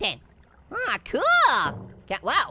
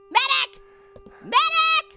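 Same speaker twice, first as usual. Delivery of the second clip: high mock-urgent call, rising on the repeat; calling for help; 9 semitones higher, 3 dB louder, much slower than usual. Gamer in trouble calls a medic, high urgent cry. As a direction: call out for a medic in play alarm.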